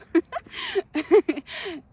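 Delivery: a high, loud burst of real laughter; bright laugh. Real, not acted. Speaking of her soul, she bursts into laughter.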